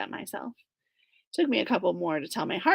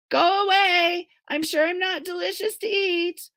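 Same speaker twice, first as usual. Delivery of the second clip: high, frightened plea; scared plea; 9 semitones higher than usual. Acted. April pleading with the bear through the door, scared. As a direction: beg the bear to leave.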